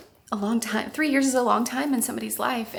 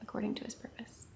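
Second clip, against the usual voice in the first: whispered; 16 dB quieter than usual. Real, not acted. Closing scripture, trailing off.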